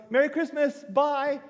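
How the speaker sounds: put-on high, sing-song child's bye; a little boy chirps goodbye; kid's cheerful goodbye. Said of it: "And the kid goes".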